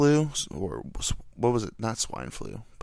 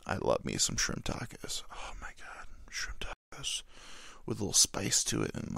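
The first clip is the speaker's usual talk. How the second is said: whispering about shrimp tacos; dreamy whisper over food; hushed craving; 3 semitones lower, 10 dB quieter than usual. Real, not acted. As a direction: breathe it out like a secret craving.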